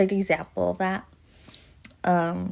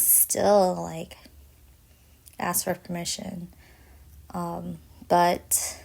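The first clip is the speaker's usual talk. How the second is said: ordinary delivery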